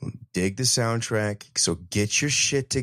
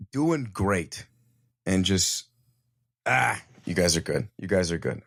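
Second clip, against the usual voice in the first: ordinary talk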